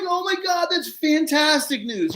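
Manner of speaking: very high-pitched delighted gushing; squealing, overjoyed reaction; retold elation. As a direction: act out being thrilled by great news. Retold delight at earthworm news.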